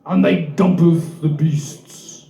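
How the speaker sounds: mock epic narration. Mock archaic slow narration.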